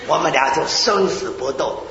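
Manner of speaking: usual speaking voice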